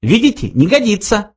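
high little mouse voice; dismissive mouse. A narrator's reading. Mouse proves the question unfit, high voice. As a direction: show it fails, pitched right up.

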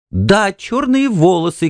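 высоко и медленно «чёрные волосы» с восторгом; выкрикнуть радостно, как разгадку; взволнованный возглас. A narrator's reading. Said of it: "крикнула она". (жена восторженно подтверждает мужу догадку)

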